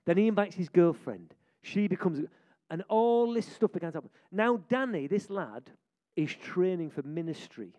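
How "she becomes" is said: ordinary delivery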